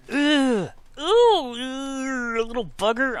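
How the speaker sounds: loud, high exasperated ugh and ooh; frustrated groans. Real, not acted. Pet keeps biting him.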